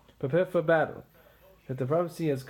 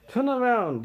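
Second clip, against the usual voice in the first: louder, higher shouted challenge; battle challenge; 8 semitones higher, 4 dB louder than usual. Acted. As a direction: call him out across the field. Macduff confronts Macbeth in battle.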